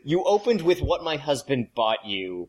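mock outrage. Real, not acted. Mock-scolding the host's opener.

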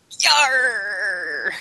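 drawn-out pirate shout; pirate yarr. Pretend. Pirate joke continued.

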